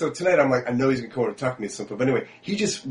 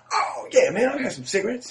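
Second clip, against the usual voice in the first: put-on higher, eager drunk voice; imitating the drunk guy; 9 semitones higher than usual. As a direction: jump at the offer like a tipsy guy. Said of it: "drunk guy's reply, voiced".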